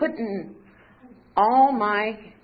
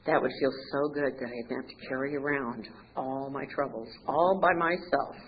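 normal talking voice

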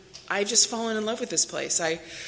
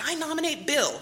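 chirpy nomination; 8 semitones higher than usual. Retold or imitated. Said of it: "She's like".

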